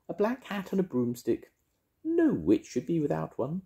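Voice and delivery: high, sing-song witchy voice; bright, bossy little witch. Acted. Mavis voiced in story.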